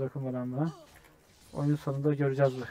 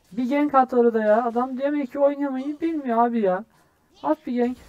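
high, raised voice of frustration; exasperated complaint; 9 semitones higher, 4 dB louder than usual. Real, not acted. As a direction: plead and complain at the jungler. Complains that jungler never ganks. (bıkkın, tiz sesle yakınma)

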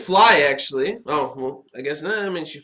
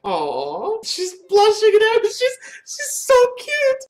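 very high-pitched adoring squeal; gushing squeal. Real, not acted. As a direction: squeal over something adorable. Gushing over blushing character.

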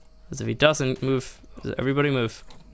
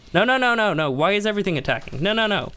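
alarmed no no no; 8 semitones higher than usual. Real, not acted. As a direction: stop it, everything is going wrong. Pets suddenly attacking everything.

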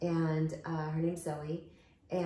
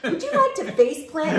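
laughing invite; 9 semitones higher, 10 dB louder than usual. Retold or imitated. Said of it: "So I was like".

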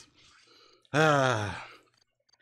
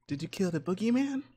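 higher mock line; mock quote; 11 semitones higher than usual. Gamer parroting the kid's line, teasing. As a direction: parrot it in a silly high voice. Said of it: "I'm crying".

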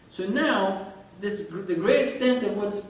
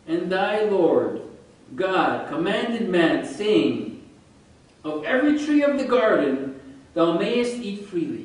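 slow, louder, weighty recitation; deliberate scripture recital, louder and slow; solemn scripture reading; 2 semitones higher, 6 dB louder, slower than usual. Acted. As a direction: intone the verse like holy writ. Reads Genesis verses aloud.

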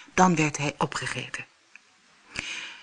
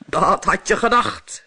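defiant big goat; 3 semitones higher, 4 dB louder than usual. Acted. Big goat defies monster.

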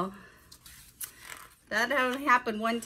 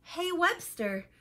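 higher, drawn-out call to a classmate; mild storybook shout; 8 semitones higher, slower than usual. A narrator's reading. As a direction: call out slowly in a raised pitch, without real volume. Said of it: "shouted Herbert".

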